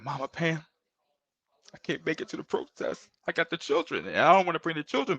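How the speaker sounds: high, strained tearful plea, partly breathless; retelling a choked-up apology through tears; retold crying plea. Father telling his mentor he can't come, tearfully. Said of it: "I was crying".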